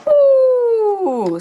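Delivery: high, loud delighted ooh; mock wonder. Marveling at the spy drone for the kids, a high ooh. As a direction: gasp with big kid-show wonder.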